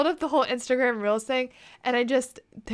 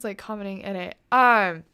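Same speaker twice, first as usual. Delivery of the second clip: normal talking voice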